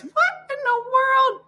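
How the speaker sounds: high, slow incredulous question; disbelief. Real, not acted. Host hearing a chat church has cameras, amazed.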